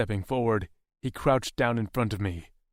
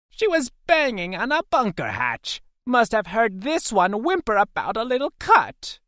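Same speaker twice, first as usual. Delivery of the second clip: high, loud creature voice; raised, jeering alien voice; alien captor; 12 semitones higher, 6 dB louder than usual. A narrator's reading. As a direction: call it out high and mocking. Second alien captor calls out.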